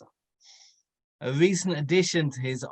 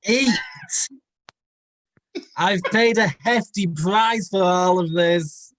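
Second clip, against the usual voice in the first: loud, higher booming lord; gluttonous lord; 6 semitones higher, 7 dB louder than usual. A narrator's reading. Demanding lord booming at dinner guest, loud and high. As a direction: bellow it like a greedy host. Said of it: "said Lord Dark as he lazily weaved the knife".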